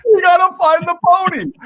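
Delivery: high, loud, excited call; excited shout. Real, not acted. Host exclaims the moral to his co-host. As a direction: shout it out with joy.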